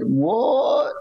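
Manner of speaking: high Southern "What?" rising in disbelief; lightly voiced Southern girlfriend; Southern drawl. Girlfriend in disbelief, Southern drawl. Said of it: "She had a strong Southern drawl".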